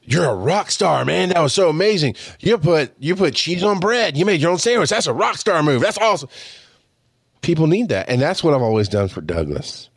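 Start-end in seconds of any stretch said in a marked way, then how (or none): 0.0-6.3 high, gushing hype with swooping pitch; hammed-up amazed congratulation; over-the-top praise. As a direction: cheer them on as if they were a star. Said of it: "that over-the-top confirmation of".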